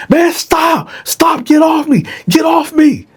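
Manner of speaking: very high, panicked yell; Dylan screaming at Bone to get off him; panicked shout. Retold or imitated. Victim fighting off attacker.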